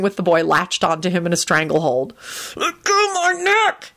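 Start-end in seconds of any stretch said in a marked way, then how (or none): 2.6-3.9 strained, high, slow plea; panting. A narrator's reading. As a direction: gasp it out from a stranglehold. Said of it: "he panted".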